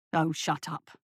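low, dismissive guard's voice. A narrator's reading. Second guard silencing his companion, low dismissal.

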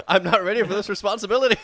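high, loud laughing protest; laughing panic. Acted.

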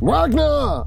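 high, drawn-out call. Acted. Player character calls for Wagner, calling out. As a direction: cry the name out across a distance.

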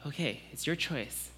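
God softly pleading with a doubter; soft fatherly plea. Pretend. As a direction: speak gently, letting them choose. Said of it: "God saying".

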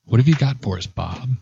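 lightly voiced deep radio voice; radio host voice. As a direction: put on a smooth announcer voice. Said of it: "he'll put on his voice".

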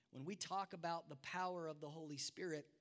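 usual speaking voice

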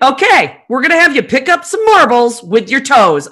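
demanding therapist. Retold or imitated. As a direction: imitate a taskmaster giving orders. Therapist turns demanding on third visit.

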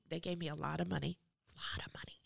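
whispered "lot of money"; hushed secret. Real, not acted. Artist telling the host about the fee, hushed whisper. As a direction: lean in and hush it like a secret.

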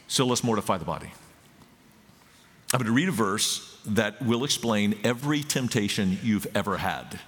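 ordinary talk

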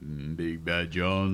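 low, slow, growly [name]; deep mock voice. Co-host deep voice joke.

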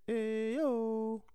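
"Hey, yo" sung high and light. Shown as a demonstration. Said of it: "and you should have said".

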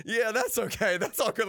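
mocking. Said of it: "Like, kinda, like, making fun of it".

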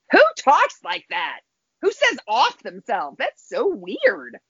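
high, slow disbelief; incredulous. Retold or imitated. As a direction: say it like you can't believe your ears. Her shocked reaction to voice.

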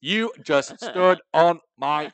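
slow, loud, high, word-by-word complaint; mock indignant. Friend ruined his joke, slow mock-indignant scolding. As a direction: spell out the grievance in a put-on voice.

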